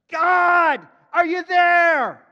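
huge, high, drawn-out shout; crying out. Acted. Preacher as Abraham calling on God, crying out loud. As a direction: call out to heaven at full voice. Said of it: "He cries out".

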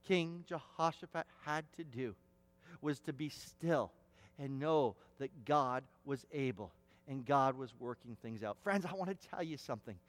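ordinary talk, nothing marked

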